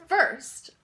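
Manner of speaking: ordinary delivery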